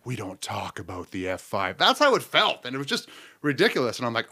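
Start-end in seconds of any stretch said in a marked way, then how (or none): 0.0-1.8 low, quiet movie-style warning; hushed dread, mock-grave. Comic imitating Twister diners at the dreaded question, hushed. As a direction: drop it low and soft, as if taboo. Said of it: "everyone drops their utensils like".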